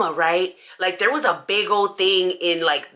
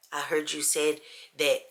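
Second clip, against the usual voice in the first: hushed taunting whisper; confronting sisters in a whisper; 4 semitones lower, 8 dB quieter than usual. Retold or imitated. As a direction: lean in and whisper.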